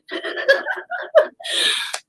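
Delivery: high, breathless giggling; long helpless giggle; real laughter. Real, not acted. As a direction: crack up at your own story. Laughing at her own story.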